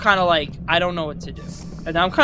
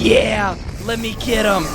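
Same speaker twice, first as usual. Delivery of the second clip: higher, eager retold reaction; raring to get at him; pitched-up eagerness; excited; 5 semitones higher, slower than usual. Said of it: "I was so fucking excited".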